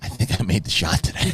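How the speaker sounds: hushed, sneaky extra; the extra sneaking a brag; whispered extra. Retold or imitated. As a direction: whisper like a proud background extra. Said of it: "what he was like".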